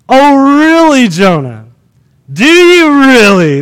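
slow, sarcastic questioning; mock disbelief. Reader answers Jonah's claim to worship, sarcastic doubt. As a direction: stretch it out, openly sceptical.

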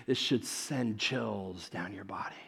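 slow breathy whisper; slow hushed whisper of awe; awed whisper. Real, not acted. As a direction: whisper it to give them chills. Building awe before the transfiguration.

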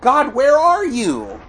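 high, drawn-out anguished cry. Acted. Preacher acting David calling to God, anguished cry. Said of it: "He'd, he'd cry out".

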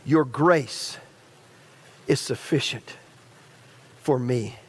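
quiet prayer. Acted. Pastor praying quietly before the church, sincere hush. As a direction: let it fall to a hushed, trusting prayer.

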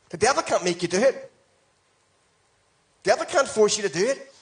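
normal talking voice

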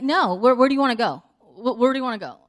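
loud, insistent husband; really irritated. Retold or imitated. Irritated husband presses his wife to choose, loudly exasperated. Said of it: "really irritated that I'm irritated".

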